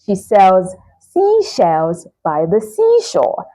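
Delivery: slow, spaced-out tongue twister; careful, unhurried demo of s and sh; slow demonstration. Shown as a demonstration. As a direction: linger on each word of the twister. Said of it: "predominant sound there is sh".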